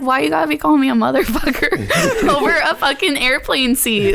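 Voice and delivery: high, laughing mock complaint; amused protest. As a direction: protest in disbelief through laughter. Retold protest at insult, laughing.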